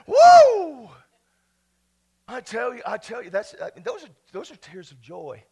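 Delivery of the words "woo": loud, high "woo" holler; joyful whoop. Real, not acted. Shouts for joy while preaching.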